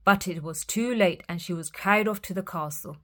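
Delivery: ordinary delivery, nothing marked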